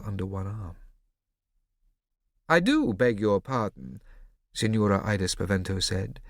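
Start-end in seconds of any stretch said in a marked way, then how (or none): 2.5-4.0 ironic politeness. Acted. Woman with rifle, ironic.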